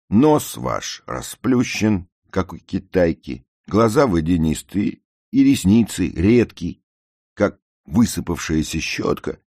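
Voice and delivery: loud, high, relentless tirade; carried away. A narrator's reading. Narrator goes on listing the girl's flaws, carried away. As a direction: hammer out each fault forcefully. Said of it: "разошёлся я".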